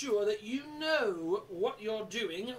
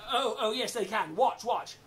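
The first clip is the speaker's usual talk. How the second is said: plain reading voice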